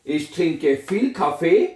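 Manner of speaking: very slow and even; slow dictation. Shown as a demonstration. Teacher slowly reading out the German sentence. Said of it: "रोजमर्रा जिंदगी में".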